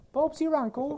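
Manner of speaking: squeaky cartoon English gent; mock British accent. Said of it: "they make fun of British people really bad".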